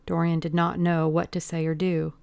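ordinary delivery, nothing marked

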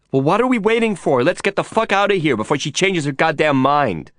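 high-pitched, brash urging; urgent, excited. A narrator's reading. Girl urging them to leave the palace, brash and urgent. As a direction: hurry everyone out before it's too late.

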